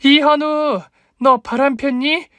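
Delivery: high-pitched, drawn-out public outcry; full-force shout. A narrator's reading. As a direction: holler the accusation from the belly. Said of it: "젖먹던 힘을 짜내어 복식 호흡으로 외쳤습니다".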